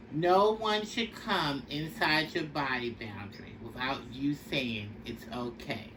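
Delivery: ordinary delivery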